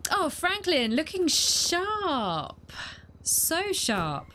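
high, slow, drawn-out flattery; mock admiring. Real, not acted. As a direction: coo it admiringly. Compliments own character.

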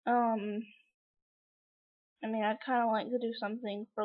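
normal talking voice

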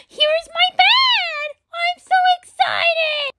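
gasping, high cartoon delight; thrilled high-pitched little character; excited toy puppy. Puppy shows its bed.

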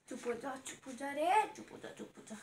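put-on low, very soft baby-hushing murmur; soft soothing hush. Mother calming her crying baby, soothing and hushed.

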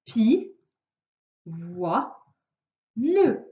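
"pi, voix, ne" said as three separate syllables with pauses between; split into syllables. Shown as a demonstration. Teacher showing a child the syllables, slow separate beats. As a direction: chop pivoine into pi, voix, ne, one beat each. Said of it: "si je découpe le mot en syllabes".

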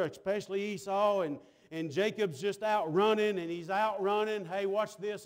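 steady, driving pulpit delivery; impassioned sermon cadence; preaching. Real, not acted. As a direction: preach it out to the congregation. Preaches with force.

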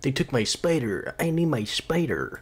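a hint of a whiny tough guy; mock tough voice. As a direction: mimic the fake tough delivery. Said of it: "imagine that sort of tone that he has".

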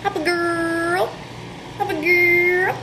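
slow sing-song pet talk. Real, not acted. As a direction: coax the dog with a rising call. Calling the dog.

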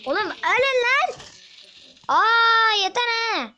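high, loud exasperated shout; frustrated yell. Real, not acted. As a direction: yell at enemies that won't die. Fighting mobs that won't die.

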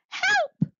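very high, squeaky cry for help. A narrator's reading. Trapped fish call out for rescue, high-pitched cry. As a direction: voice little fish calling out in alarm. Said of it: "cried the fish".

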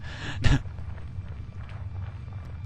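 amused burst of laughter; laughing. Real, not acted. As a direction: laugh at yourself. Laughing at own bad accent.

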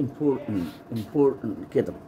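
important with no T sound at the end; impor-tant, final t silent; dropped final t. Shown as a demonstration. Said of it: "ما انطقش الت اللي في الآخر".

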